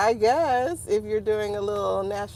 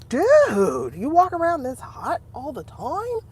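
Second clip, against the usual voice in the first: imitating an incredulous reaction, pitch rising; mock disbelief; 5 semitones higher, 4 dB louder, slower than usual. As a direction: act out baffled amazement at someone. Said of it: "And you're like".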